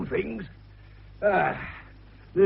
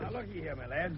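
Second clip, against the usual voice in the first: confiding; 13 dB quieter than usual. Acted. Fell confides a secret.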